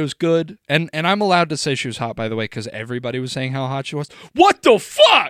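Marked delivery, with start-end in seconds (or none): 4.3-5.3 shouted in disbelief; shocked outburst. Real, not acted. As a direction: blurt it out, stunned. Shocked she found the rabbi.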